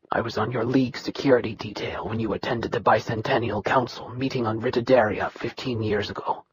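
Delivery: low, soft, level voice of a bitter son; dry steady Nikirian. Acted. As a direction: hold down old hurt, flat and quiet. Nikirian recalls the council meeting.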